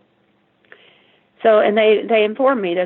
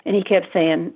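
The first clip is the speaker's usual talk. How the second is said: ordinary delivery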